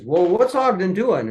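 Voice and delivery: a retold wife cutting in on the photo shoot; bossy wife. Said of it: "she says".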